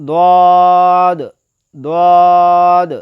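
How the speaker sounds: দদ full-mouthed, loud and long held; thick heavy d. Shown as a demonstration. Teacher models the letter, thick loud দদ. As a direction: stretch দদ heavily from the side of the tongue. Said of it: "মোটাভাবে আদায় হবে".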